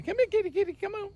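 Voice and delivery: high cooing pet voice; puppy voice. Acted. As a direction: coo at the kitty sweetly. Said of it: "I have to get my puppy voice".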